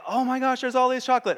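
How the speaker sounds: excited chocolate lover spotting a full box; mock excited. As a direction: gush over the chocolates like a happy kid. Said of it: "you get really happy".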